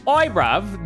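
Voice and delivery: put-on loud-ish, cheeky British street accent; mock British accent. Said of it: "Is your character English?".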